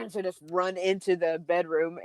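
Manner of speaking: ordinary talk, nothing marked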